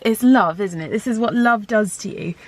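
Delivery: normal talking voice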